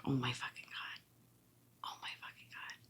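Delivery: unvoiced whisper, oh my fucking God twice; whispered disbelief. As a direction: breathe it out in stunned horror. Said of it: "And I'm like".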